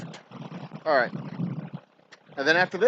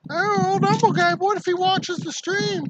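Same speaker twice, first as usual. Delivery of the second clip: much higher, louder squeaky voice; squeaky mock voice; 6 dB louder than usual. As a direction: squeak it out like a cartoon. Mocking squeaky voice about viewer.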